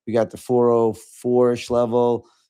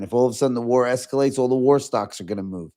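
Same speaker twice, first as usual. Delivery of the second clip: normal talking voice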